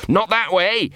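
high-pitched anxious whine; whining. A narrator's reading. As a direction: whine a panicky warning. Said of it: "whined Gaspode".

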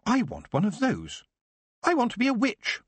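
put-on high, eager boy's voice; a boy set on becoming a witch; young Geoffrey. Boy telling the old man he wants to be a witch, eager and high. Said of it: "he pointed up and said".

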